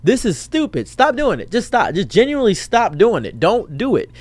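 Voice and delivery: high, swooping pleading; exasperated plea. Real, not acted. Vlogger telling joke-makers to stop, exasperated plea. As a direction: beg them to quit, thoroughly fed up.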